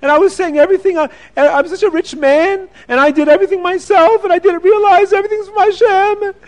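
high, loud crying voice; rising wail of remorse; tearful confession. Acted. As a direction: sob it out high and full of regret. Rich man repents, crying.

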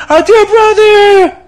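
very high, slow stretched mock wail; milk the false grief to the crowd; drawn-out fake wail.

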